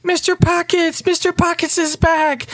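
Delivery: put-on high-pitched children cheering a favorite entertainer; screaming excited kids. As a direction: squeal it like a delighted little kid. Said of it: "eruption of screams and laughters".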